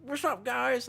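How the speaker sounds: high, bright-pitched "What's up, guys?"; mock influencer greeting. Streamer mocking his own influencer greeting, high and hyped. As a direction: squeak it out like a hyped TikToker. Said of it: "'cause I'll be like".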